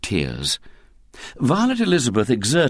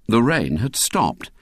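ordinary delivery

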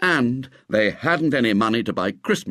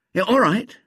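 higher, easy-going yes; lightly voiced boy agreeing; 12 semitones higher, 4 dB louder than usual. A narrator's reading. Ginger agreeing with William, higher boy voice. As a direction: give in happily. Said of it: "agreed Ginger".

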